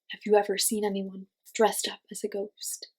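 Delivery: ordinary delivery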